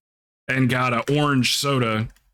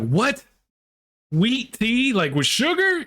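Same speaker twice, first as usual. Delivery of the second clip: incredulous; 10 semitones higher, slower than usual. Retold or imitated. People baffled by sweet tea.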